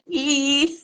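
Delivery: a high little squeal imitating a face; playful high squeak; squeaky mock squeal. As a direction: squeak it out like pulling a funny face. Imitating Debbie's face at camera.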